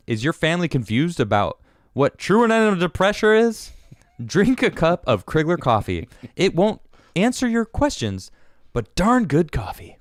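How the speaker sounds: high, loud ad announcer voice. Acted. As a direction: pitch it like a hyped fighting game announcer. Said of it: "read it in my announcer voice".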